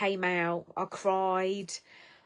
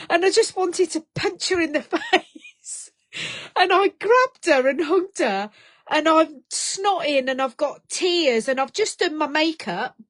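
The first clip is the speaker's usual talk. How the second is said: crying while laughing; 6 semitones higher, 3 dB louder than usual. Real, not acted. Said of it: "am crying my eyes out".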